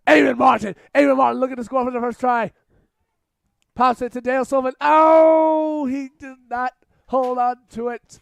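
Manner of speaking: high, loud, fast commentary climbing to a shout; excited play-by-play. Real, not acted. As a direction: call the break at full volume, then groan as he drops it. Live commentary building to try attempt.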